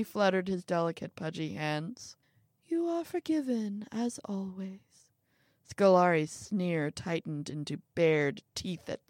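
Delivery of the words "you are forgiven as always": bestow forgiveness lightly, pitched up; lofty high-voiced absolution; airy pardon. Little man airily pardons old man.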